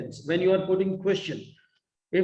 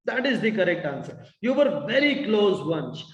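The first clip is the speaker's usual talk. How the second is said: usual speaking voice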